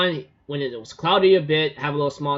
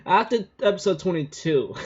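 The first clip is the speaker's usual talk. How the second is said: usual speaking voice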